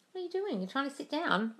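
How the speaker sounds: high, soft pet-talk voice; cooing to a pet. Real, not acted. Host to her cat trying to sit down, cooing. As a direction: coo it softly, pitched right up.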